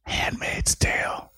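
hushed conspiratorial whisper. Acted. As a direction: whisper so the government can't hear. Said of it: "Why are you whispering?".

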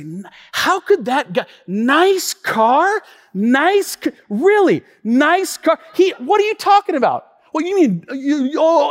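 high, sputtering outrage; hammed-up wounded pride; acted indignant disbelief. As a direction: repeat it in offended disbelief. Replaying the remark, offended.

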